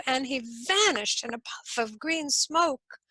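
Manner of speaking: ordinary delivery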